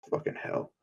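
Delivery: very soft, voiceless swearing; whispered exasperation; muttered curse. Acted. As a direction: curse under your breath. Deku curses after being scolded.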